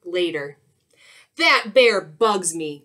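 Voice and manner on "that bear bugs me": loud, grumpy skunk growl; annoyed storybook skunk. A narrator's reading. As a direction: growl it in irritation. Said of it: "growled Skunk".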